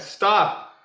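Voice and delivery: sudden loud high shout of stop; screamed stop. Shown as a demonstration. Magician shows spectator how to mentally scream, loud shout. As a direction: bark the word like a command. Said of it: "just scream in your head".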